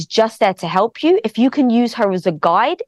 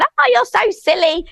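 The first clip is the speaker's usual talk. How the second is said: lightly acted amused parent, higher pitch; laughing parent; 11 semitones higher, slower than usual. Parent reacting to a baby's fall, mock amusement. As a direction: imitate a tickled parent, pitched up. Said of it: "if a parent starts laughing".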